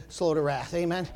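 usual speaking voice

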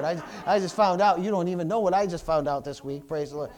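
ordinary talk

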